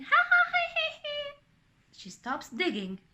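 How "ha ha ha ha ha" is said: put-on child's ringing ha-ha; high loud acted laugh. A narrator's reading. Anna laughing in the waves, loud laugh. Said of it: "hears Anna laughing".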